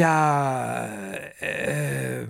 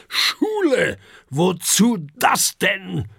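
much higher, louder outraged outburst; angry father dragon; 5 semitones higher, 4 dB louder, slower than usual. A narrator's reading. Father dragon outraged that his son wants school. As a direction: explode at the idea of school. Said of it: "Ruft Herbert wütend".

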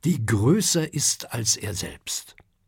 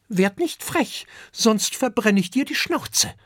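put-on high, cheeky threatening child; little dragon snapping back at a rude stranger; hissing back; 11 semitones higher, 3 dB louder than usual. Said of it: "faucht Kokosnuss zurück".

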